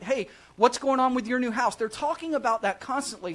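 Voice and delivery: ordinary talk, nothing marked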